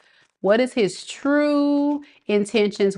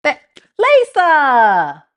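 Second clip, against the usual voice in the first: long, slow, drawn-out call, higher and louder; 7 semitones higher, 6 dB louder, much slower than usual. Real, not acted. Tarot reader calls out to a missing viewer, drawn-out call. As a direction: stretch her name out like a call across a room. Calling out for Lisa.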